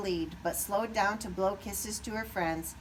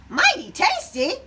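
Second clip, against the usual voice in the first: high, loud alarmed cry; shouted; 12 semitones higher, 12 dB louder than usual. A narrator's reading. Cassie overhearing the farmers, loud alarmed shout. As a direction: jump up in shock. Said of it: "Cassie shouted".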